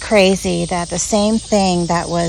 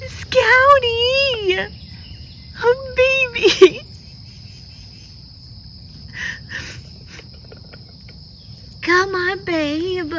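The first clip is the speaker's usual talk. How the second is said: much higher, voice wet with tears; high tearful coaxing; 12 semitones higher, much slower than usual. Real, not acted. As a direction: coo at him through tears. Tearful reunion with lost pet.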